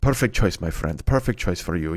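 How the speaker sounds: put-on low, hushed approval as the old deli man. Deli owner approving a customer's order, low and hushed. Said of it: "He goes".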